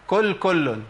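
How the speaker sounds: كل كل, both words with the same front k; slow "kul kullun", the faulty merging in كل كل; qaf turned kaf. Shown as a demonstration. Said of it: "طيب، الخطأ فين يا شيخ؟".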